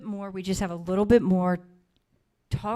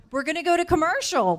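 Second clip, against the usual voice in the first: higher and louder call to the room; host's raised call to commercial; 9 semitones higher, 7 dB louder than usual. Real, not acted. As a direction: announce the break over the noise. Host calling the break.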